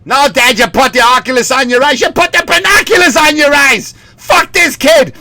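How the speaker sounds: high, loud Boston squabble; mock family quarrel. Son and dad bickering over present, high mock fury.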